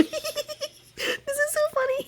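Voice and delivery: very high, giggly creature voice; giggling mermaid. Acted. A giggling merfolk mocks the scared adventurers, squeaky and gleeful. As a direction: giggle at your own joke. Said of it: "little giggles down below".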